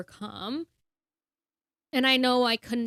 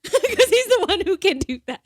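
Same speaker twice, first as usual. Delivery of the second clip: words spill out through a real laugh, higher and louder; laughing while speaking; 9 semitones higher, 6 dB louder than usual. Real, not acted. Laughing while praising Jesus.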